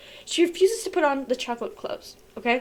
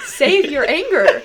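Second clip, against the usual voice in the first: laughing; 2 semitones higher, 7 dB louder, slower than usual. Real, not acted. Laughing at own warning.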